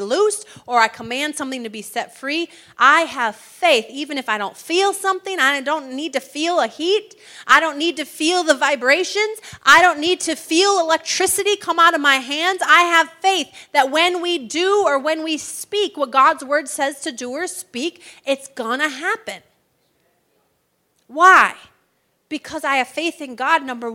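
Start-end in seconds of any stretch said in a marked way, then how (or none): 2.8-19.4 louder and higher, building with conviction; rising fervent preaching. Real, not acted. Preacher declaring his faith to the congregation, building louder. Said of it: "preacher building to a declaration". 21.1-21.5 a sudden loud, high why; shouted question. Real, not acted. Preacher challenging the congregation with a loud question. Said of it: "preacher's rhetorical burst".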